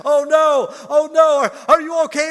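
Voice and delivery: high loud hammed-up panicked cry; mock panic. Grandpa mocking his own panic over hurt grandson. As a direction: play the frantic grandpa.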